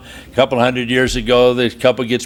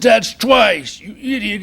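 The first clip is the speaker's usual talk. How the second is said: mock shout at donkey; 8 semitones higher, slower than usual. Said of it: "The guy said".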